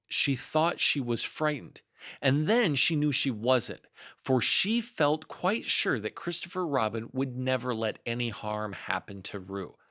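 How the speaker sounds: usual speaking voice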